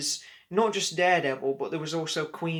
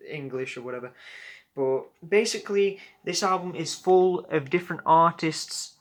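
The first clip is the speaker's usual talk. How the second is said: ordinary talk, nothing marked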